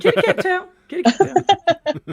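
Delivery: high, loud kitty-cat squeal; playful silly voice. Pretend. Playful sing-out of a catchphrase.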